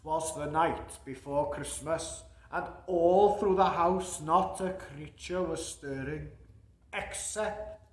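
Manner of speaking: hushed storybook opening, dropping to a whisper on except; reading to children. A narrator's reading. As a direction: bedtime story voice.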